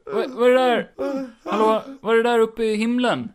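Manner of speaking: loud, high panicked shouting; hammed-up sky gazer; acted alarm. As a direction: call out at the sky in mock alarm. Skit: spotting something in sky.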